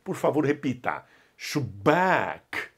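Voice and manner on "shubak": "Shubak" with doubled b and stretched a; "Shubak" low, stressed on a long final syllable; long A, double B. Shown as a demonstration. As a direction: lean on the b, then hold the a in "Shubak". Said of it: "A esticado e dois Bs".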